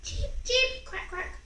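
high little cheeping then quacks; baby bird cheeps. Acted. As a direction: chirp like chicks. Baby birds call.

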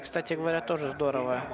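normal talking voice

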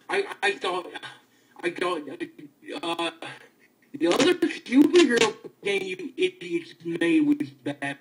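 normal talking voice

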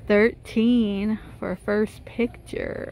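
ordinary delivery

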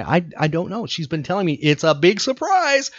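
ordinary talk

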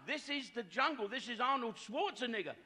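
high-pitched, emphatic contradiction; exasperated insistence. Retold or imitated. Husband insisting to his wife it is the jungle, high and emphatic.

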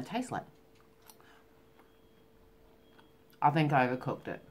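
talking while chewing; speaking between bites; mouth full. Real, not acted. Seen 0: Forks meat into mouth at 1.1, then chews while talking.

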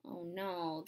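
ordinary delivery

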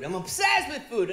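ordinary talk, nothing marked

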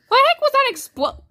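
high, loud shocked outburst; startled shouting. Real, not acted. Gamer startled by an explosion on screen, startled shouting. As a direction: yell in high-pitched bewilderment.

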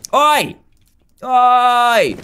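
loud high oi at the dragon; calling out. Real, not acted. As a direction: shout to provoke it. Shouting at dragon.

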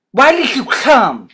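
heated, high and insistent question; angry demand. A narrator's reading. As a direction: demand it forcefully, drawing the words out. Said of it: "he demanded".

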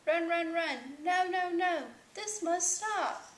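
high, loud protest; exasperated storybook outcry; crying out. A narrator's reading. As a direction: cry out in protest, slowing hard on the last words. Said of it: "cried Hugo".

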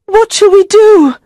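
very high, loud panicked queen; high-pitched frantic plea; desperate cry. A narrator's reading. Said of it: "she cried".

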